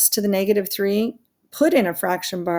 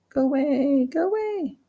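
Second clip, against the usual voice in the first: high voice climbing higher on the repeat; high-pitched shooing; 6 semitones higher than usual. Real, not acted. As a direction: shoo it away in a small, rising high voice. Shooing off an on-screen nuisance.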